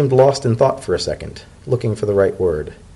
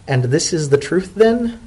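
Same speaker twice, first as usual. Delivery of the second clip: higher, rising sceptical elder; probing the truth, pitched up; zebra elder; 7 semitones higher than usual. Acted. Macaulay testing Dodded's honesty, a high rising question.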